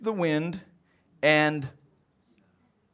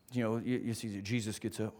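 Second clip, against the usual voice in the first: normal talking voice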